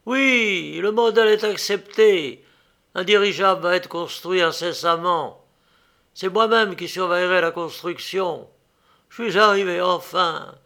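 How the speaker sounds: high, loud, elated announcement; triumphant. A narrator's reading. Inventor tells the young woman his airship is accepted, triumphant. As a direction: burst out with the good news.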